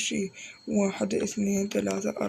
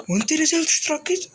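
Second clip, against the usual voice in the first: higher, louder question to the viewer; excited call-out; 7 semitones higher, 4 dB louder than usual. Real, not acted. Gamer hyping up a new subscriber, excitedly. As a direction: call out to a fan in the chat.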